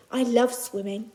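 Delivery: usual speaking voice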